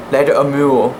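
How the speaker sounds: plain reading voice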